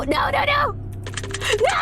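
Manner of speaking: high, panicked no no no; a sudden burst of high alarm. Real, not acted. Panicking as the game breaks.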